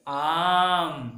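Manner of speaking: आम said slowly with a long clear आ; model word. Shown as a demonstration. Teacher modelling आम for children to repeat. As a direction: draw out आम for pupils to copy. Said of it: "आपको भी उच्चारण करना है".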